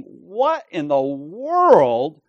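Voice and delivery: slow, loud, incredulous exclamation; astonished shout. Acted. Jesus marveling at their ignorance, astonished shout. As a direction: cry it out in disbelief. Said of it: "he's shouting, he's exclaiming".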